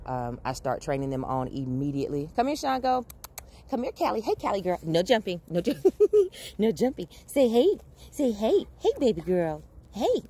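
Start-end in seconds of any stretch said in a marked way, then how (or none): 2.4-10.2 puppy talk. Real, not acted. Greeting excited puppies jumping up.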